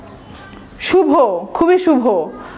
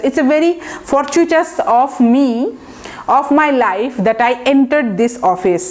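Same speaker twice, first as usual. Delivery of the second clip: slow example sentence; much slower than usual. Shown as a demonstration. Teacher models example sentence slowly.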